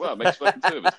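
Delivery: high, laughing comeback; laughing retold retort. Telling friends his reply to the driver, laughing. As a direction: crack up while delivering the retort.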